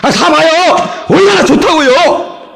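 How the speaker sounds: high, eager vendor's cry; fiery sales pitch. Acted. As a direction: sell it with gusto. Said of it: "뭔가 좀 화끈해야 뭐가 좀 막 열정이 있어야".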